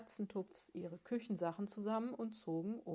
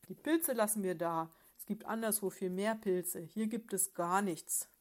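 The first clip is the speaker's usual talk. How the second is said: loud, spiteful retort; the husband bear, snorting angrily at his wife; nasty snort; 6 dB louder than usual. Acted. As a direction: snap back, snorting with spite. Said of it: "schnaubte bösartig".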